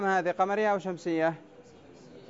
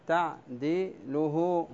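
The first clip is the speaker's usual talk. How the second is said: تعديله drawn out syllable by syllable, very slow; slow scansion; much slower than usual. Shown as a demonstration. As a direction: chant تعديله beat by beat for the class. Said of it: "سأقطعها بالأسود حتى يتضح". (تعديله ببطء شديد للتقطيع العروضي)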